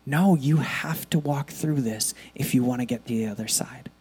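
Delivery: soft whisper, firm and gentle; God whispering: no, you must go through. Acted. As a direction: lean in and whisper the hard truth. God's voice, whispered.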